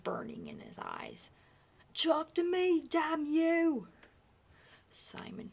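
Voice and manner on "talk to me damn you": furious shout, much higher and louder; enraged father screaming at his son; raging shout. Acted. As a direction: bellow at the silent child.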